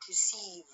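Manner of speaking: re-CEIVE, weight on the end of "receive"; stress on last syllable. Shown as a demonstration. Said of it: "the last part or the last syllable".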